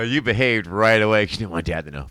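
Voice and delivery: ordinary talk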